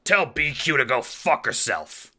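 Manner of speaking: furious parting shout; parting cry. Acted. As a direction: spit it out as you're thrown out. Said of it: "you cry".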